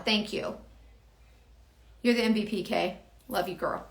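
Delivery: ordinary talk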